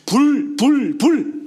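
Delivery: loud, high bursts, one word each; impassioned exclamation. Acted. Pascal exclaims as fire comes on him, impassioned. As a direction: exclaim it as if burning inside. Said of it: "그래서 그가 하는 말".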